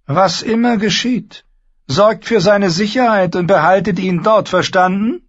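grab him and insist; stern instruction. Acted. Tyrion orders Asmund. (eindringlicher Befehl)

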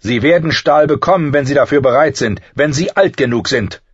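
sharp. A narrator's reading. Master-at-arms refusing live steel, sharp and loud. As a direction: lay down the rule sharply. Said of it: "sagte Ser Roderick scharf".

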